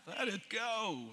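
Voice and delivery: singing through a laugh. Retold or imitated. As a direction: laugh while finishing the line. Laughing through sung quote.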